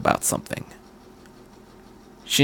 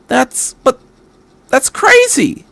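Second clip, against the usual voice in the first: very high voice cracking in disbelief; boy panicking at a crazy plan; shocked; 10 semitones higher, slower than usual. Acted. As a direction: squeak it out in alarm. Shinji shocked by Misato's plan.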